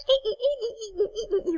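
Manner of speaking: high squeaking ee sounds through a laugh; squeaky trigger noise. Retold or imitated. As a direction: mimic a squeaking controller trigger. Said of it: "triggers are really like".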